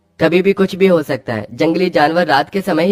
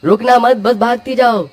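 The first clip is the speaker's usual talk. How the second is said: urgent urging; 7 semitones higher than usual. Acted. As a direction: urge her on while fleeing. Urging wife to keep running.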